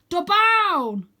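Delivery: high, loud command yell. A narrator's reading. Arthur yelling a command into Vlad's ear. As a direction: shout the magic word at the dragon. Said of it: "lean close to Vlad's ear and yell".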